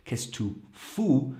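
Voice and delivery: slow, pointed and irritated; much stronger tone. Shown as a demonstration. Teacher showing the cruder, stronger question. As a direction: press it, clearly annoyed. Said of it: "a lot stronger".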